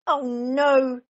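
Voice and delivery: loud, high exasperated groan; Mister Bear at wit's end; fed up. A narrator's reading. Mister Bear kept awake by Baby Bear, groaning loudly. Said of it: "said Mister Bear".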